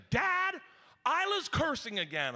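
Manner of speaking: put-on high-pitched kid calling for Dad; tattling daughter. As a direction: pipe up high to report your sister.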